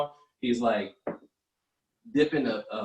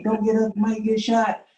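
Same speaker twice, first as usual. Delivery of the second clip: urgent warning; 9 semitones higher, 6 dB louder than usual. Retold or imitated. Warning during drive-by.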